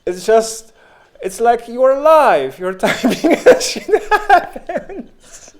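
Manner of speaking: can barely talk for laughing. Real, not acted. Cracking up about Discord.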